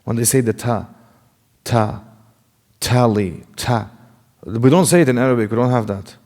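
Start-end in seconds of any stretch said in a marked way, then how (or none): 1.6-3.9 ta and tally with aspirated English T; a puff of air after each T; English-style breathy T in tally; North American T. Shown as a demonstration. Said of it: "how they say it here in North America".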